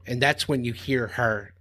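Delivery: ordinary delivery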